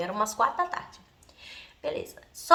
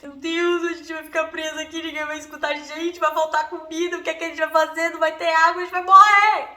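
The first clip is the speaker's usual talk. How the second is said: dramatic panic; 4 semitones higher, 3 dB louder than usual. Retold or imitated. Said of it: "primeira coisa que aparece na minha cabeça".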